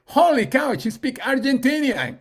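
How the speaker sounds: high, loud astonished reaction; amazed. Retold or imitated. Woman reacting to ChatGPT speaking Argentinian, amazed.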